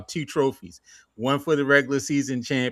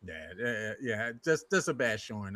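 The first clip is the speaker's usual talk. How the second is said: ordinary talk, nothing marked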